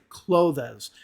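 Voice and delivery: clothes as two-syllable "KLOH-thez"; clothes with an extra EZ ending; mispronounced clothes. Shown as a demonstration. Teacher repeating learners' mistaken clothes as "kloh-thez". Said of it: "if you pronounce it like clothes".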